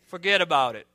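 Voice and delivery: playful New York accent with "Forget about it" punched loud. Shown as a demonstration. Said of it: "Who has the best New York accent here?".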